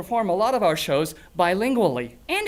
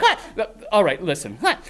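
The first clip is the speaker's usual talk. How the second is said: usual speaking voice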